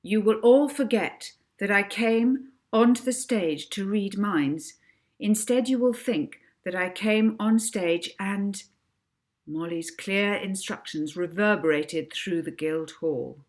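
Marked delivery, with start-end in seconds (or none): 0.0-8.6 hypnotist's command. Acted. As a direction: lay the instruction on them like a spell. Molly hypnotises the audience.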